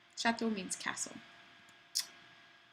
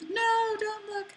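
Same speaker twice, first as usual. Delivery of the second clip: playful warning; 12 semitones higher, 3 dB louder, slower than usual. Real, not acted. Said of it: "telling students not to peek".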